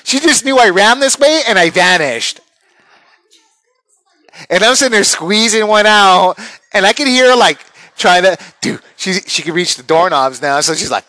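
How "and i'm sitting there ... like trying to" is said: usual speaking voice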